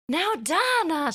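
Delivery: high, slow Rhode Island mom voice; imitating her mom's thick Rhode Island accent. Daughter imitating her mom in a Rhode Island accent. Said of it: "she had this really intense Rhode Island accent".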